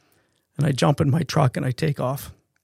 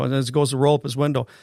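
plain reading voice

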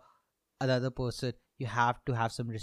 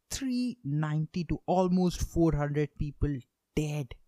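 aghast disbelief; 3 semitones higher than usual. Real, not acted. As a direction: let the number sink in, horrified. Aghast at the death toll.

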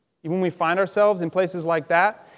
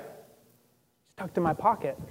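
soft reassurance; 3 semitones lower, 10 dB quieter, slower than usual. Real, not acted. Reassuring aside after doubt.

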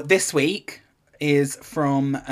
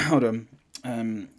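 usual speaking voice

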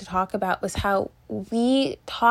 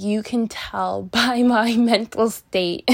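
louder, breaking into a real laugh; 5 dB louder, slower than usual. Real, not acted. Vlogger joking to viewers about their own state, laughing. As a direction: say it through a laugh, drawn out.

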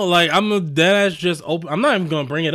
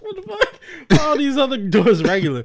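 high confused outburst, laughing; mock confusion; 9 semitones higher, 3 dB louder than usual. Passenger baffled by the doors, high and laughing. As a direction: act baffled, pitch jumping up. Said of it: "they're like".

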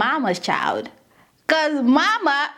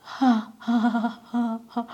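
soft, breathy huffs of shock; mock stunned breaths; stunned panting; 5 semitones lower, 5 dB quieter than usual. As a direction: huff softly, as if overwhelmed. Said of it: "it's probably like".

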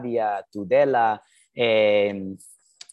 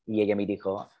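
usual speaking voice

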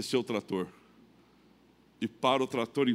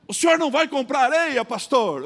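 higher, louder mock creditor; creditor's question; 11 semitones higher, 7 dB louder than usual. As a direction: play the big creditor asking. Creditor Alfredo questions him.

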